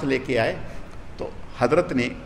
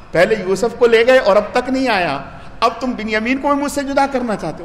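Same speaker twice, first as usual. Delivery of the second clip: reproachful father; 6 semitones higher, 4 dB louder than usual. Acted. Grieving father reproaches his sons.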